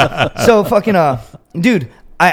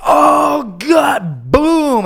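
straining relief; 11 semitones higher, 5 dB louder, much slower than usual. Retold or imitated.

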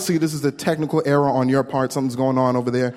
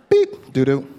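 card machine beep; slower than usual. Retold or imitated. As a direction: imitate the card reader rejecting you. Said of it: "the machine makes a noise".

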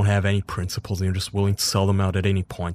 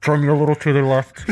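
creepy dad through webcam; 6 semitones higher, 4 dB louder than usual. Pretend. Bear voiced as dad via cam.

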